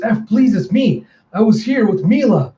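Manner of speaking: acted begging to be recognised; desperate pleading. Pleading with frenzied loved one.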